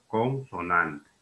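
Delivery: "Consonante" drawn out very slowly; slow, deliberate teaching emphasis; careful emphasis. Shown as a demonstration. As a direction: stretch the word out so students take note. Teacher repeats key term for care.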